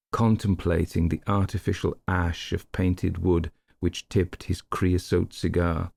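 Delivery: plain reading voice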